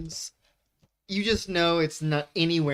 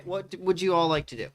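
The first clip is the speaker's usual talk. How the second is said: normal talking voice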